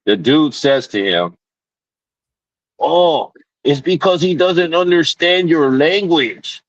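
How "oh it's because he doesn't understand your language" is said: high mocking voice of the clerk; mimicking the cashier's silly excuse, much higher. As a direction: sing it high like a clueless clerk.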